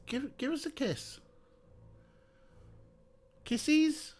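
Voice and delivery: soft, very high falsetto; high sing-song pet voice. Real, not acted. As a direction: plead with the cat in a tiny high voice. Begging the cat for kisses.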